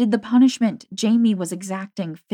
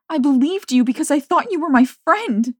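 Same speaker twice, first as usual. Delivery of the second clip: voice breaking; 8 semitones higher, 7 dB louder than usual. A narrator's reading. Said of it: "her voice broke in anger or pain".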